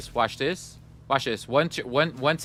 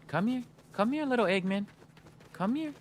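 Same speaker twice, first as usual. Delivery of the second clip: coaxing; 4 semitones higher, 8 dB quieter than usual. Acted. Coaxing a pet-like Eggman over.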